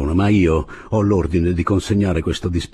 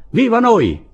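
high, loud rallying cry; exultant high yell; shouted cheer; 6 dB louder than usual. A narrator's reading. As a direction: cheer for your own side. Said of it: "gridò Andrés".

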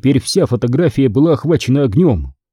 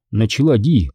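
ordinary delivery

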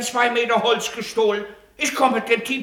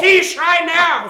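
louder, higher, outraged retort; angry shout; 6 semitones higher, 7 dB louder, much slower than usual. Acted. The man yells back at the official, outraged. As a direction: throw the accusation right back. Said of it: "aber schreien Sie nicht so!".

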